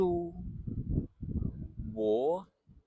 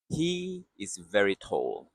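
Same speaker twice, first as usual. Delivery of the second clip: ordinary talk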